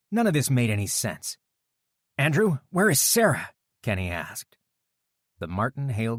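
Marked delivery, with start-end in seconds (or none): 2.2-3.5 higher, louder urgent plea; urgent question. A narrator's reading. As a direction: press him for an answer.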